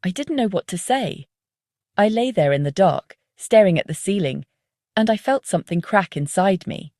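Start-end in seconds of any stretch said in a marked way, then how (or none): none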